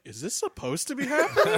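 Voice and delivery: high, laughing, baffled question; laughing disbelief. Retold or imitated. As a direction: ask it while laughing in disbelief. Retelling being set on fire.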